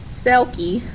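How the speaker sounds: usual speaking voice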